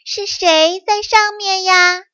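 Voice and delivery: high, loud call up out of the pit; sly fox calling out loudly. Acted. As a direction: fox shouting up to a stranger above. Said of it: "便大声地喊道".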